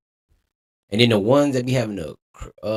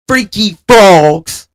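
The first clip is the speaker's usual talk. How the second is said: higher, louder, slow hammed-up catchphrase; mock yell; 9 semitones higher, 11 dB louder, much slower than usual. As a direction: stretch it out and belt it. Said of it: "as Reese would say".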